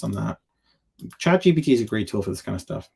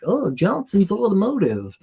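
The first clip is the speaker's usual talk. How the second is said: much higher, louder, sing-song mockery of the ad; mock dealer-frame voice; 10 semitones higher, 5 dB louder than usual. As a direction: sneer at the dealer's name in a put-on voice. Mocking the dealer frame branding.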